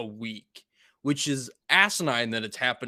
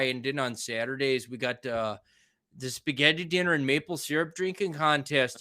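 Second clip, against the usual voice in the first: folksy Wisconsin local rambling on; Wisconsin accent; 2 semitones higher than usual. Retold or imitated. Local describing Friday fish fry, exaggerated Wisconsin accent. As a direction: put on a lilting Wisconsin accent. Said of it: "with that accent".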